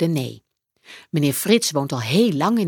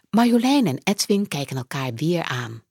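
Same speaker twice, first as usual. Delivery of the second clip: usual speaking voice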